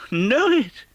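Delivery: high, astonished little cry; surprised at the question itself; delighted Scrooge. Acted. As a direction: Scrooge recognising his old workplace, delighted. Scrooge recognises the warehouse.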